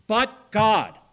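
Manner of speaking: a loud, ringing "but God"; emphatic proclamation. Real, not acted. Preacher booming the key phrase to the congregation. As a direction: declare "but God" with sudden force.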